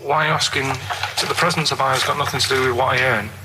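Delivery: ordinary talk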